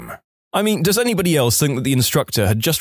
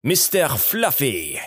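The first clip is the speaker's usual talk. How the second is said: slow, drawn-out French-Canadian summons; French-Canadian call-out; 4 dB quieter, slower than usual. A narrator's reading. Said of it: "she would yell out".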